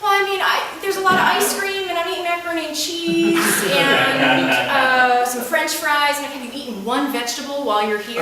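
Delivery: put-on high whiny girl voice; lightly mocking teenage whine; mimicking the camper. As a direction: play the defensive kid, voice up high. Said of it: "she comes to me and says things like".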